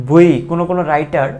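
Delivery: ordinary talk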